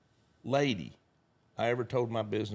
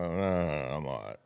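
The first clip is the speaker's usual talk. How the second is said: bad mood; 6 semitones lower, slower than usual. Grumpy traveler answers the cheery kid, bad-mood mumble. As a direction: grumble it back, pitched right down. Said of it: "my mood is bad".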